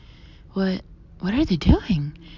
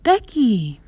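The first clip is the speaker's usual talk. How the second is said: stilted exclaim; 4 semitones higher, 9 dB louder than usual. A narrator's reading. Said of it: "Mom exclaims in a stilted voice".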